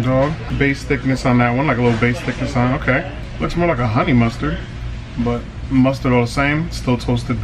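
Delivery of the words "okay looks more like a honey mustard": ordinary talk, nothing marked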